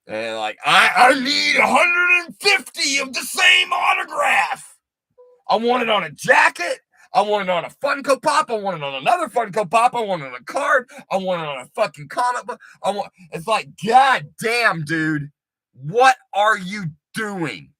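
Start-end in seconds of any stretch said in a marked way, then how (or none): 0.6-13.1 mocking a greedy fan, much higher and loud; demanding collector. Mocks obsessive autograph collector. 13.8-17.7 exasperated outburst. Retold or imitated. Retells own exasperated reaction.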